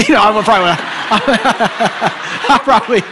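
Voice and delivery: laughing loudly through the words; cracking up at his own joke. Real, not acted. Laughing, admitting exaggeration.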